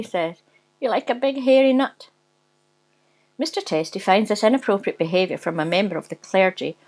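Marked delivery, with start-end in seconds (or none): 0.8-2.1 high-pitched teasing Bishop; mocking Bishop. A narrator's reading. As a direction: taunt him in a higher Bishop voice. Laughing Bishop mocks Mister Testy.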